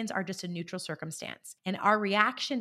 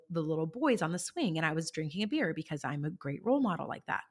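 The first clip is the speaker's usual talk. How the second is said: normal talking voice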